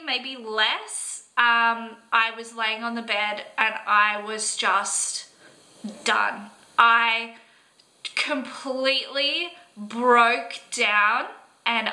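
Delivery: ordinary talk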